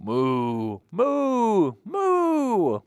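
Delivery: playful cow calls for toddlers; cow mooing. Acted. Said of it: "the cows need milking".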